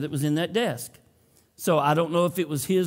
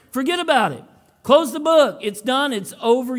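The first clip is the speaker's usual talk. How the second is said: higher, louder mock New York tough guy; hammed-up New York accent; 8 semitones higher, 6 dB louder than usual. Pastor jokes to the congregation in a bad New York accent. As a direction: ham up a Brooklyn fuhgeddaboudit, badly. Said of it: "I'm not very good at that accent".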